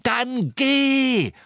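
high, drawn-out angry bellow; thundering shout. A narrator's reading. Harry ordering Ron to leave, thundering shout. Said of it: "donnerte Harry".

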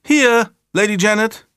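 high, loud answering shout; little girl calling back obediently; dutiful child's call. A narrator's reading. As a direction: call out like a well-behaved child. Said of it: "rief aber artig".

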